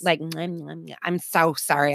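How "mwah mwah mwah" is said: soft wet lip smacks; wet mouth clicks. Retold or imitated. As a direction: smack the lips wetly. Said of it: "I had to imitate it".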